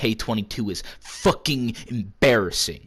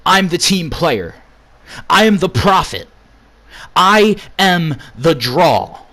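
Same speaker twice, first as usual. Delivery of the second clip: bold boast; 6 semitones higher, 6 dB louder than usual. Acted. Boasting promo.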